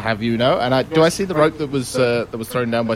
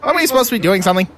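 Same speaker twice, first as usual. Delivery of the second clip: quick, higher impatient question; Rizzo; 7 semitones higher, 3 dB louder, much faster than usual. Acted. Rizzo nudging the group back on task, quick and higher. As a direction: rattle it off impatiently. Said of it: "He's like".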